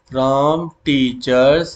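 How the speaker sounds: very slow, word by word; slow dictation. Shown as a demonstration. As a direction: spell it out for students to copy. Teacher dictating SQL query.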